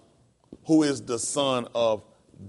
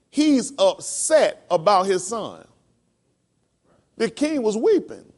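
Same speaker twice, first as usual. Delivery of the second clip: higher, louder, the preacher climbing in fervor; preaching at full force; 7 semitones higher, 5 dB louder than usual. Acted. Preacher building to the class, preaching with rising fervor. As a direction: drive the point home to the congregation.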